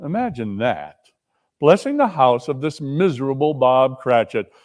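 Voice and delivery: ordinary delivery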